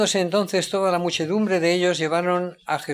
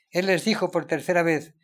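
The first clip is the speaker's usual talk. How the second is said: usual speaking voice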